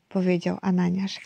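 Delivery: ordinary delivery, nothing marked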